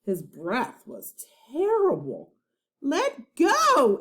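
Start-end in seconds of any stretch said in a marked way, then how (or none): 2.8-4.0 high loud shout. A narrator's reading. Annie shouting in the pirate's face, high loud shout. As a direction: shout right in his face. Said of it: "Annie shouted in his face".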